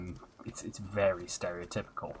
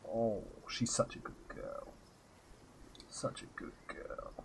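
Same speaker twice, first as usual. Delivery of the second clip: soft cat praise; 13 dB quieter than usual. Real, not acted. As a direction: coo softly over a good cat.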